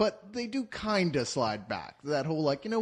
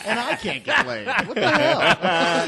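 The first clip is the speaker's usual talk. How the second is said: laughing; 5 semitones higher, 5 dB louder, slower than usual. Real, not acted. Laughing joke about a character.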